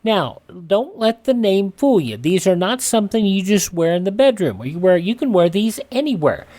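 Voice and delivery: ordinary delivery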